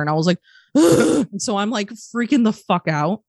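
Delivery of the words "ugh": high, anxious "ugh"; high-pitched groan of retold dread. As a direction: groan as if dreading their arrival. Retold dread after dad's call.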